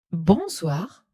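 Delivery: usual speaking voice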